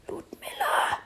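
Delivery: a stifled yell pushed out as a whisper; suppressed shout. Acted. Lando calls Ludmilla back from the creature, stifled whispered shout. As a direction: call her name without making noise. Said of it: "brüllte er unterdrückt".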